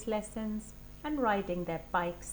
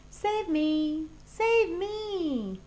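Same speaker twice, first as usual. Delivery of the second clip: high, loud pleading shout; cry for help; 9 semitones higher, 7 dB louder, much slower than usual. Acted. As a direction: shriek to be saved. Child crying out.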